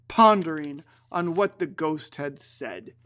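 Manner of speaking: usual speaking voice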